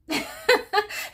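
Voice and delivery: bursting into high laughter; a sudden high-pitched laugh. Real, not acted. Laughs at the character's recklessness.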